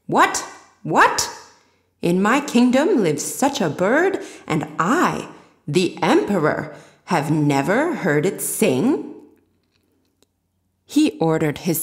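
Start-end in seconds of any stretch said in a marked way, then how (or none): 0.1-1.3 high, loud startled "What? What?"; a king jolted by news he never heard; indignant royal astonishment; surprised emperor. Acted. 2.0-9.1 a king offended he was never told; pompous disbelief; indignant emperor. Acted.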